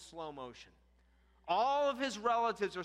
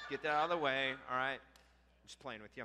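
usual speaking voice

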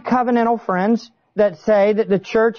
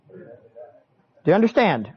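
ordinary talk, nothing marked

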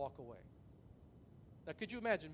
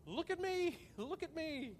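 high, laughing mock boast; mocking show-off; 11 semitones higher, slower than usual. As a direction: mimic someone preening for attention, much higher. Mocking a show-off before God.

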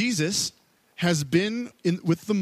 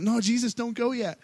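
higher, eager plea from the crowd; pleading crowd; 7 semitones higher than usual. Retold or imitated. Crowd pleading with Jesus as he leaves in the boat, high eager plea. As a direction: beg him not to leave yet.

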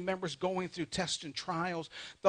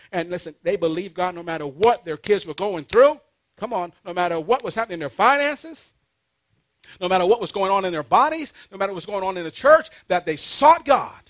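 loud, driving pulpit list; building preaching fervor; 11 dB louder than usual. Real, not acted. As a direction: pile up each phrase loudly, then land it. Preacher builds through repeated phrases.

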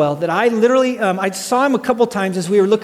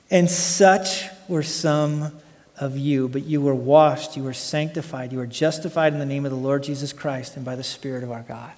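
solemn scripture reading; 6 semitones lower, 4 dB quieter than usual. Real, not acted. Preacher reading the verse to the congregation, low and solemn. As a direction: read it low and reverently, weighing the opening words. Reads scripture aloud, lowered.

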